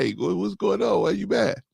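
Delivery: husband mocking his wife's innocent call. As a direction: mimic her innocent asking. Wife's call, mimicked again.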